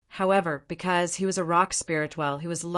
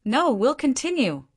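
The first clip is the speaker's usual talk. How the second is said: stubborn insistence, pitched up; 7 semitones higher, 4 dB louder than usual. A narrator's reading. Stone Emperor refuses to quit the fight, stubborn. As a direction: dig in, higher and firm. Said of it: "said stubbornly".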